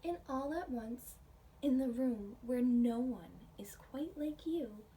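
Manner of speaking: gentle storybook reading with hushed whispered stretches; reading to children. Acted. Teacher reading a picture book aloud to her class.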